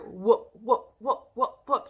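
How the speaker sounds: rhythmic spoken smack noises; imitated hitting sounds. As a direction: voice a string of repeated blows. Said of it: "She's hitting her".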